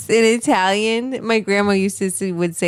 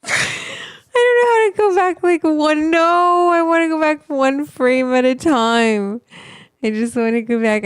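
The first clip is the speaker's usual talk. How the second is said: much higher and louder, whining; frustrated high whine; 8 semitones higher, 6 dB louder than usual. Real, not acted. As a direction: whine like you can't make it work. Struggling to rewind video.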